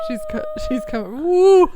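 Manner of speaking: high, fast and thrilled, ending in a loud whoop; excited. Real, not acted. Excited as the baby arrives.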